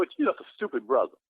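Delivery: ordinary delivery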